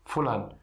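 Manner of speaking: Fulano said the Arabic way, ending in a clipped n; Arabic pronunciation. Shown as a demonstration. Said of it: "A gente fala fulano". (pronúncia árabe de Fulano, sem a vogal final)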